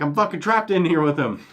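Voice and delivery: high, loud retold complaint; mock alarm. Trapped player calling to his friend, alarmed. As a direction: call it out like you're stuck with a menace. Acted cry for help to friend.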